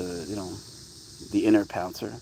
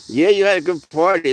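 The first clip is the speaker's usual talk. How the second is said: bright, higher and louder praise for the cat; cheery pet talk; 7 semitones higher, 7 dB louder than usual. Real, not acted. Owner cheering his cat about his party, higher and louder. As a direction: gush at the cat like it's his birthday.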